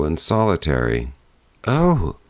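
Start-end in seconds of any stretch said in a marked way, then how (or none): 1.7-2.2 higher gasping oh of horror; horrified cry. A narrator's reading. Mother hearing of the night visitor, crying out in horror. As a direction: cry out in shock. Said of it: "cried the mother in horror".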